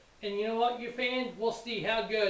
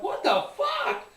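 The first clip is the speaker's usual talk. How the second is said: slow, far higher shocked cry with a laugh; 5 dB louder, slower than usual. Retold or imitated. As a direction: squeal it out in disbelief. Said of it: "I'm like".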